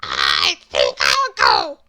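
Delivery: very low, slow, puzzled voice; hesitate, baffled and deep; confused Mister Small. Acted. Bewildered Mister Small gives up, slow and very low. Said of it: "said the confused Mister Small".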